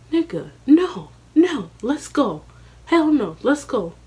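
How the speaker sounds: high, insistent "no, let's go"; pleading to get out; urgent refusal. Retold or imitated. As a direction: push him to leave now. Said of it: "And I'm like".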